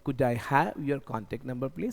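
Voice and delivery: slow, careful, rising on please; slow polite request. Shown as a demonstration. Teacher asks politely for a contact number, slowly. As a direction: say it gently and slowly, like a model answer. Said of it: "ऐसे बोलूँगा".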